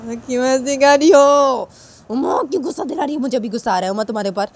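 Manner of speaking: put-on boy getting angry. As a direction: build toward anger. (ऊँची चिढ़ी आवाज़ में लड़का)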